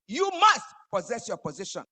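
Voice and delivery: loud, high shouted call; preached shout. Acted. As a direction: shout it to the congregation.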